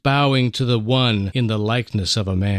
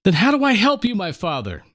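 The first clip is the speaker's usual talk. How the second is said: helpless plea; 6 semitones higher, faster than usual. Acted. Son begging grieving father.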